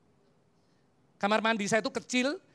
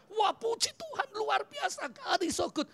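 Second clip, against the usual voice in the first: much higher, excited rave; imagined gushing praise; 13 semitones higher than usual. Retold or imitated. Preacher imagining wife's thrilled reaction, high excited gush. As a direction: gush like you just heard a miracle. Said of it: "Saya harapkan saya dapat revelation ini bilang".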